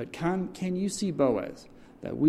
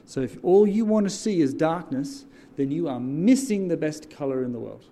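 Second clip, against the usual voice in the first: louder rising preacher's call, rallying the crowd; preaching at full force; 4 semitones higher, 4 dB louder than usual. Acted. Pastor rallies the congregation with call and response, preaching loud. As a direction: drive each line home and wait for the yes.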